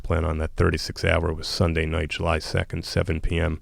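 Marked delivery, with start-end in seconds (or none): none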